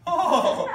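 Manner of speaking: long, high, loud mock-scandalised oh; hammed-up wail of horror; mock-horrified "oh". Teacher mocking alarm over a student's fight, mock horror.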